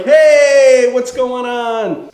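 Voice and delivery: long, loud, high "hey"; hammed-up party hello called out; cheery greeting shout. Couple popping out of the car to friends, cheery shout.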